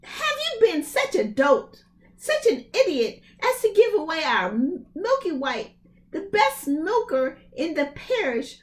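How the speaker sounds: high, loud scolding; scolding mother. Acted. Mother scolding Jack over the beans, high and loud. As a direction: tell off the foolish son.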